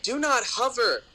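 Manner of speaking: slow, high, stern Wisconsin mom; firm mom voice telling a kid off; stern warning. Retold or imitated. Mom warns kid away from phone.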